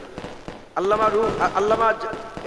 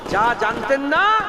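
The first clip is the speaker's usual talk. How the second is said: slow emphatic quote; 2 semitones higher, slower than usual. Acted. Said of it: "একবার জোরে বলেন".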